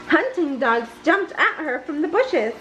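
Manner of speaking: usual speaking voice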